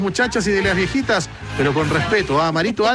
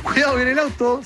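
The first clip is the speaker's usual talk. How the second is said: higher-pitched street warning call; mock warning call; 8 semitones higher than usual. Host re-enacts kids playing ball spotting a car, warning call. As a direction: call out a pretend heads-up.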